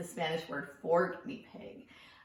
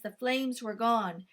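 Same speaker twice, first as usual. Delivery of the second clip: usual speaking voice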